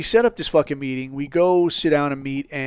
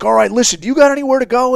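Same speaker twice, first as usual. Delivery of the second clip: raised, urgent questioning; hurried and commanding; urgent and loud; 9 semitones higher, 7 dB louder, faster than usual. Retold or imitated. Urgent instructions to informant.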